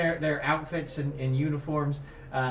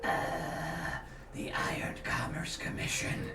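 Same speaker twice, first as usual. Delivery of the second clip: ominous goblin growl; 4 semitones lower, 8 dB quieter than usual. Acted. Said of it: "growls under his breath real ominously".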